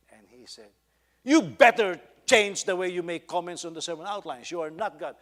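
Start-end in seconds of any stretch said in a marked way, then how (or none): 1.2-5.2 loud, raised retold outburst; angry rebuke. Offended pastor warns the homiletics teacher about his comments, angry and loud. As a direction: lay down a warning at high volume.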